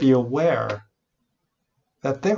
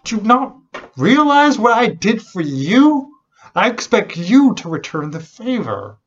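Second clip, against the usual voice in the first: raised, high-pitched indignant rebuke; furious master; 8 semitones higher, 5 dB louder than usual. Retold or imitated. Master confronting the wicked servant he forgave, furious. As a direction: scold in outrage, voice pushed high. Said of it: "he's furious. He's like".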